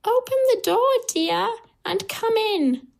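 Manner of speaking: very high granny voice; high, welcoming storybook grandmother. A narrator's reading. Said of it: "says the grandmother".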